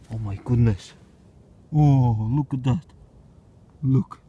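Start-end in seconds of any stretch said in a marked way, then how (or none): none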